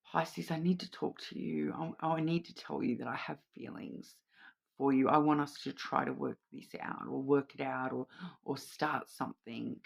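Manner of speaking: hushed, heartfelt confession of feelings; a lover quietly confessing feelings to Pisces, hushed; soft confession. Retold or imitated. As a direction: say it softly, as if opening your heart. Someone confessing feelings to you.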